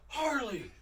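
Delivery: high, loud retold holler; acted coach's shout from across the court; yelling coach. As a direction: call the player out like an irate coach.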